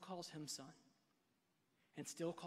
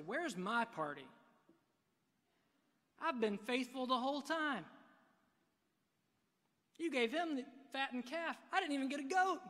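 a hammed-up aggrieved complaint, rising higher and louder; resentful older brother; 6 semitones higher, 6 dB louder than usual. Older brother complaining to his father, aggrieved rising whine. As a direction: play the jealous brother whining to his father, building. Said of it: "basically the brother says".

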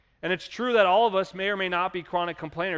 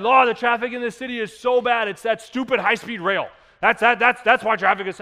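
higher, louder irritated grumble; mock complaining; 5 semitones higher, 7 dB louder than usual. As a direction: rant about traffic to God. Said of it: "We complain".

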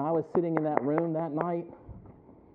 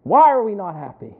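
loud preaching; 9 semitones higher, 11 dB louder than usual. Real, not acted. Preacher pressing the congregation, loud and rising. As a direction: hammer the point home.

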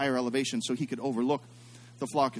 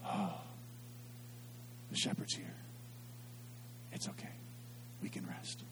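hushed relieved whisper of sheep at rest; 4 semitones higher, 18 dB quieter than usual. Retold or imitated. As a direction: breathe it like a calmed sheep. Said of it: "would make the sheep go".

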